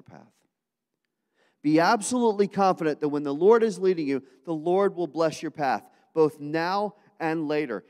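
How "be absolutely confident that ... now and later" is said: louder and higher, driving the principle home with full force; preached louder. Shown as a demonstration. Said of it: "second spiritual principle for today".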